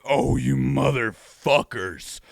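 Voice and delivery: drawn-out, slow resentful curse; slow mock fury. Retelling his anger at the trailer lottery, slow mock fury. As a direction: growl it out slowly at the developers. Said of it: "And I was like".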